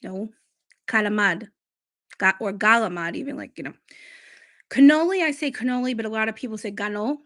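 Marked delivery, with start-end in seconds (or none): 0.9-1.5 "Calamad" said as "gala-mahd", final vowel dropped; "Calamad" in the Italian-American way. Shown as a demonstration.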